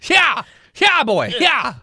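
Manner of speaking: loud whooping yeah; excited shout. Real, not acted.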